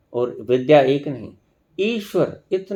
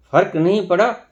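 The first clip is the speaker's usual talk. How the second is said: ordinary delivery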